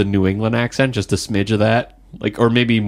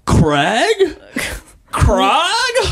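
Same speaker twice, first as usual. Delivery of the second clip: much higher, drawn-out calls of Craig in hammed-up shock; soap-opera surprise. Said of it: "the Californians".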